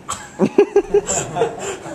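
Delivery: a high giggling burst; high laugh. Real, not acted. Comedian laughing at the bikini text, high giggle. Laughing at his own story.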